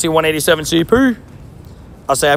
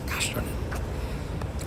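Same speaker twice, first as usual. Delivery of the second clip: slow, much softer whispered curse; slow whispered curse after a missed throw; frustrated whisper; 14 dB quieter, much slower than usual. Real, not acted. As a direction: breathe the curse under your breath.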